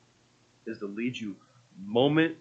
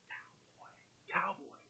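very soft, breath-only prompt; whispered cue; 6 semitones higher, 17 dB quieter, slower than usual. Retold or imitated. Imitating wife cueing the cowboy voice from the front row, whispered. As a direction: mouth it across a room in a whisper.

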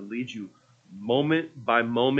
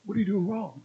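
hushed prompting; 7 semitones higher, 6 dB quieter than usual. Retold or imitated. Front-row helpers prompting actor on stage.

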